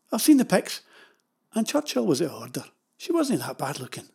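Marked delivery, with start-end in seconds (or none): none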